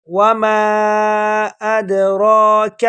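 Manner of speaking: وما ادراک very slow, heavy را; tajweed; correct recitation. Shown as a demonstration. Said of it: "ایسے پڑھیں گے".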